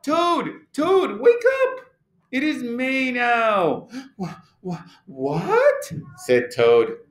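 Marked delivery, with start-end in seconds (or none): 0.0-3.9 high, urgent calling; excited wake-up call. A narrator's reading. Frog waking Toad with news of May, excited high call. 4.2-5.9 startled stammer. A narrator's reading. Toad woken by Frog's news, startled stammer.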